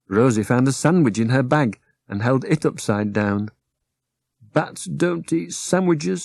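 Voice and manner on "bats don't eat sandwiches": high, soft, slow little bat; drowsy bat correcting them gently; a high, dreamy little bat; sleepy bat. Acted.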